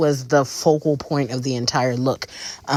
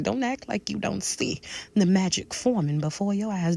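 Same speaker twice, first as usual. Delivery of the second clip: ordinary delivery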